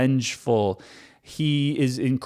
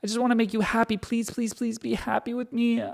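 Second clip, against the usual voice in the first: softly pleading; 8 semitones higher, 6 dB quieter, faster than usual. Retold or imitated. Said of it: "God's the abusive boyfriend".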